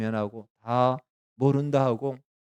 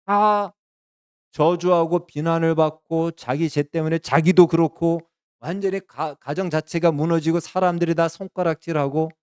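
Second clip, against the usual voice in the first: loud, raised preaching surge; impassioned preaching; 5 semitones higher, 5 dB louder than usual. Real, not acted. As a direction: pour it out with building intensity. Preacher at full force. (큰 소리로 고조되는 설교)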